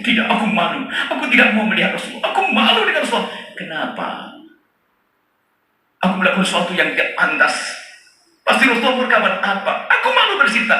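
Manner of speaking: put-on high, pleading boy's voice; ashamed boy. Boy refusing to face the Prophet, high and ashamed. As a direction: beg off in shame, pitched high.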